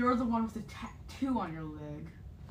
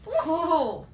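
loud happy whoop; excited cheer; 8 semitones higher, 6 dB louder than usual. Real, not acted. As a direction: cheer with delight. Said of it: "I'm just really excited".

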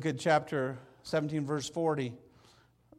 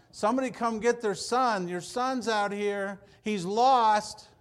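higher, louder jeering call; mock giant taunt; 7 semitones higher, 9 dB louder, slower than usual. Preacher acting Goliath taunting David, loud jeer. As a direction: call out the lost kid like a mocking giant. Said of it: "He's got some kid out here".

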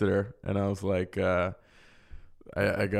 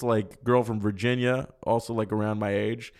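normal talking voice

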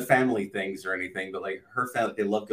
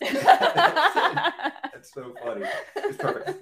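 high, stretched laughing words; 4 dB louder, much slower than usual. Real, not acted. Laughing at joke.

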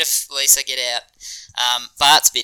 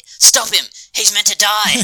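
high, loud Scorpio yell; blunt shout; 12 semitones higher, 8 dB louder than usual. Retold or imitated. Quoting Scorpio ordering guards, high loud shout. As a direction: bark the order. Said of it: "Scorpio just says very bluntly".

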